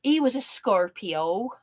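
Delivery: hammed-up Sussex country voice; exaggerated Sussex twang. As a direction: imitate a rural Sussex twang. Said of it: "it's a Sussex twang".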